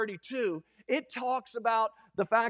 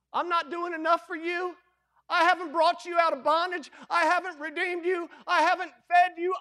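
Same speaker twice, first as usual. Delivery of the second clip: fed-up God; 6 semitones higher, 4 dB louder than usual. Retold or imitated. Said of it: "you know that God says".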